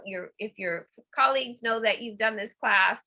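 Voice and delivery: ordinary talk, nothing marked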